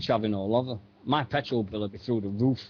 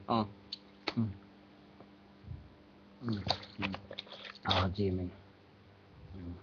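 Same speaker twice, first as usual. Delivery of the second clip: while sipping a drink; 4 semitones lower, 13 dB quieter than usual. Real, not acted. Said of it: "Can you hear me drinking".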